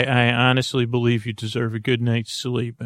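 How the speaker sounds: ordinary delivery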